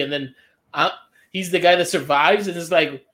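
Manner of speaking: normal talking voice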